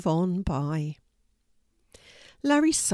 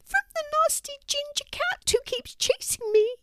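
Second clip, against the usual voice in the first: very high little mouse voice; frightened complaint in a tiny squeak; squeaky mouse. A narrator's reading. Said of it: "squeaked the mouse, all of a quiver".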